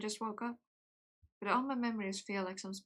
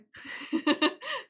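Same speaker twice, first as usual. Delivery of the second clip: bright burst of laughter; loud high laugh; 9 semitones higher, 8 dB louder than usual. Real, not acted. Laughs at own tiredness.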